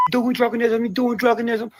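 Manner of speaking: squeaky high mocking phrase. Mocking the strange wording, squeaky high. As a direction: pitch it way up to mock.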